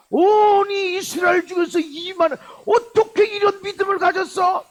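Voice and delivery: high, loud astonished shout; amazed exclamation. Acted. Preacher acting amazement at centurion's faith, astonished. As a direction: exclaim it in wonder, high and forceful.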